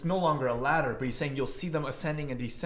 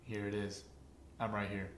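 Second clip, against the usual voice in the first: low, soft and tender; gentle reassurance; 6 semitones lower, 10 dB quieter than usual. Pretend. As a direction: say it like a quiet promise. Voicing Jesus softly.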